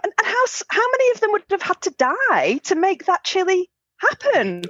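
high, outraged ranting; hammed-up indignant teen; indignant disbelief. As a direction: rant at the absurd chili. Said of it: "we're like".

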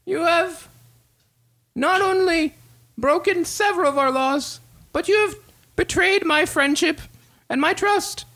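high, formal, betrayed king; a grave king, pitched well above his own voice. Acted.

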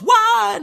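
loud, high mock roar from a crowd; imitated crowd cheer. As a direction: answer a rally call with a pretend whoop. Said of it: "then everyone goes".

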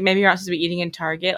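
fast anxious guessing; rushed thought. Retold or imitated. Guessing why the officer stopped her, fast rattled. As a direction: rattle it off fast, half panicked. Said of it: "I was like".

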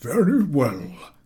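slow, high very well; satisfied professor. A narrator's reading. Professor accepting the answer, slow. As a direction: accept it grandly.

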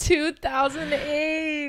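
slow, stunned repeat, pitched high; shocked echo. Real, not acted. Friend echoing the death date in stunned disbelief.

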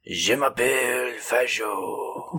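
put-on Japanese accent. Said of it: "He started doing a Japanese voice again".

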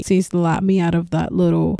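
ordinary delivery

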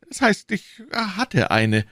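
high, slow, hesitant correction; nervous backpedalling. Acted. Nervous shopkeeper corrects himself under questioning.

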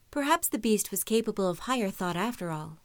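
plain reading voice

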